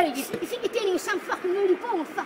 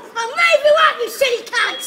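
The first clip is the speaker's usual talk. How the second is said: louder, raging threat; angry yell; 2 semitones higher, 10 dB louder than usual. Real, not acted. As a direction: bark the threat right at him. Threatening a man in a street fight.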